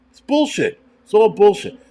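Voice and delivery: jumps high and loud, disgusted; indignant outburst. Real, not acted. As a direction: spit it out, fed up with the scam. Ranting at the diamond trade.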